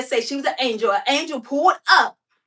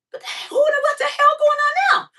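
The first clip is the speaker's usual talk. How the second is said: alarmed shout; 13 semitones higher than usual. Real, not acted. Woman in her car hearing screams, alarmed high shout. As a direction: yell out in shock at the screaming.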